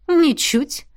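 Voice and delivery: snorting. A narrator's reading. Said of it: "фыркнула она".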